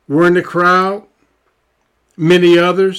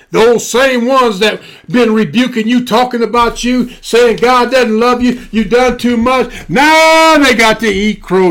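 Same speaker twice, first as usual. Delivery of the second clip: preaching at full force; 4 semitones higher than usual. Acted. Preacher tells his congregation the doubters must eat crow, rising to a shout. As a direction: build it up and shout the climax.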